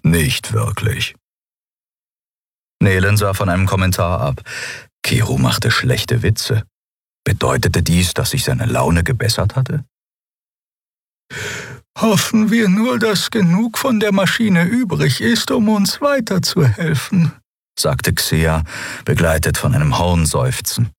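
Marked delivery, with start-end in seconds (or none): 0.0-1.2 lower, slow growly reply; gruff grumble. A narrator's reading. Keru grumbles a dry answer, lower and slow. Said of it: "knurrte der Skria". 12.0-17.3 much higher, otherworldly voice; high creature voice. A narrator's reading. Xia hopes the machine still helps, in a high voice.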